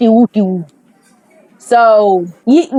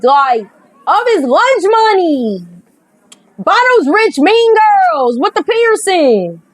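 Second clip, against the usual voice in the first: usual speaking voice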